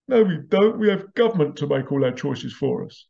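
mock the people who let government choose; mock sheep.

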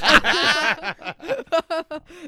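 loud, high laughing fit; helpless, delighted laugh; real burst of laughter. Real, not acted. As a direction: crack up at the joke. Laughing at a listener's quip.

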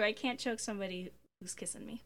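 breathy whispered aside; softly whispered quip. Real, not acted. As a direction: whisper it as a joke.

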